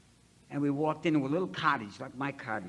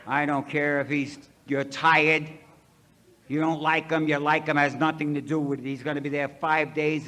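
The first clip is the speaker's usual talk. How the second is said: louder, firm sponsor laying down the law; stern scolding; 8 dB louder than usual. Retold or imitated. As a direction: bark the orders like a tough old timer. Sponsor scolding him on phone.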